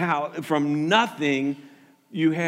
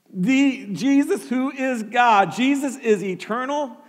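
slow, louder, pitch raised; slow, emphatic proclamation; proclaiming; 4 semitones higher, 3 dB louder than usual. Acted. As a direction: preach it out with conviction. Preacher proclaims.

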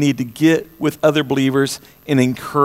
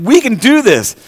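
high, bold declaration; preacher quoting a faithful churchgoer; confident faith; 7 semitones higher, 3 dB louder than usual. Retold or imitated. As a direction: claim it like a believer. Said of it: "somebody's saying".

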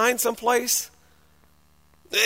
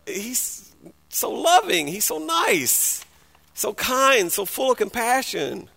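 mock-sweet gushing; 3 semitones higher, 4 dB quieter, slower than usual. Preacher parodying the nice-only view of Jesus, mock-sweet. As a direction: gush sweetly in a raised voice.

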